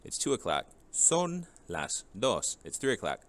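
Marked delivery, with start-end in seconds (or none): 0.9-2.6 slow, clear "Son las dos" with "son" stressed; slow model phrase. Shown as a demonstration. Teacher drilling the class, slow and clear. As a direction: spell out each word slowly for the class. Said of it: "teacher models time".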